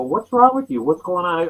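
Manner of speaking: mock alarm at a friend acting odd; startled friends. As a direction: friends asking what's wrong, high and startled. Friends' startled retold question.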